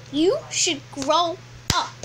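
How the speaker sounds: "You should grow up" broken by pauses, slowly; word-by-word scolding. Real, not acted.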